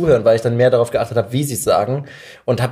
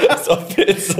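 laughing; 10 semitones higher, much slower than usual. Real, not acted. Laughing back at the tease.

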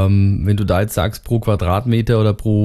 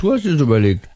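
crunching chips; 5 semitones higher than usual. Real, not acted. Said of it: "Ich esse grad Chips".

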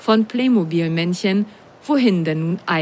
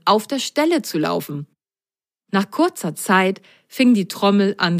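plain reading voice

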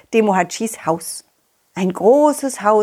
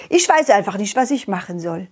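ordinary talk

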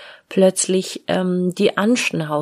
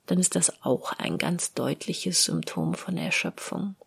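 ordinary talk, nothing marked

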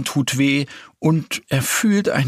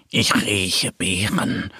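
slow, low, savouring; sniffing little bear; 4 semitones lower, slower than usual. A narrator's reading. Little bear smells berries in the forest, savouring.

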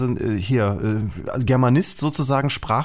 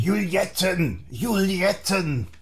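put-on slow, drawn-out offstage call from a smoky old nurse; old smoker nurse calling; 5 semitones higher, 4 dB quieter, much slower than usual. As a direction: call her name long and slow like a raspy old nurse. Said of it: "hat viel geraucht, deswegen klingt die so".

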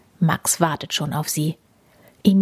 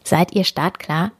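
ordinary delivery, nothing marked

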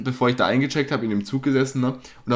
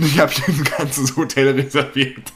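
laughing through the words; cracking up while saying it; words squeezed out between real laughs; 2 semitones higher than usual. Real, not acted. Laughs mid-quote at own joke.

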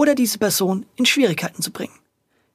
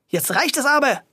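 higher, firm reprimand; stern shout; 6 semitones higher than usual. A narrator's reading. Policewoman stopping the grocer, high stern call. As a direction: stop him at once. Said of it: "schrien darauf Frau Wölkchen an".

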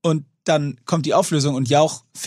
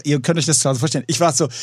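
ordinary talk, nothing marked

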